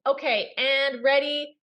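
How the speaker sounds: imitated on-set "ready" call; director cueing. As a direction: count the actor into the take. Film crew cueing the take.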